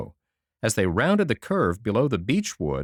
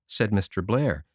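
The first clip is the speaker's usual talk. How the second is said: ordinary delivery, nothing marked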